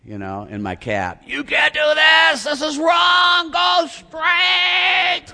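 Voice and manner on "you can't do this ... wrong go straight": loud high yelling, slow; furious shouted tirade, much higher; retold shouting. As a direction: yell it out the cab window. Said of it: "And I'm screaming it".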